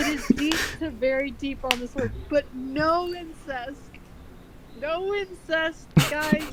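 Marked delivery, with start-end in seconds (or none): 2.3-6.4 slow, drawn-out denial; slow mock insistence. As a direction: drag out no incest.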